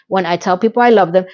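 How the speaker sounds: usual speaking voice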